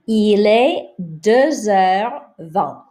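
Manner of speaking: Il est deux heures vingt slow, linking deux into heures with Z; slow French model sentence; slow liaison demo. Shown as a demonstration. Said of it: "Here we have a liaison".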